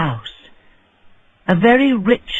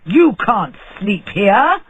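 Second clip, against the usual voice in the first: slow, pressed scold; annoyed scolding; 4 semitones higher, 3 dB louder, slower than usual. A narrator's reading. Rich man scolding the dwarf on his doorstep, irritated. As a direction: tell him off firmly. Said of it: "he shouted angrily".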